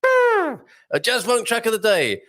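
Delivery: high, slow, drawn-out fanfare call; jingle-style announcement. Pretend. Host relaunches the daily track feature, announcer-style. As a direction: herald the feature title in place of horns. Segment announced again, wanting horns.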